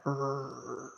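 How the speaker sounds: low, soft imitated growl; imitated dog growl. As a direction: mimic a quiet animal growl under your breath. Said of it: "just growling".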